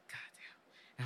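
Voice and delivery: under-the-breath whispered "God damn"; whispered curse. Retold or imitated. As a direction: hiss it nervously under your breath. Nervous man curses under breath.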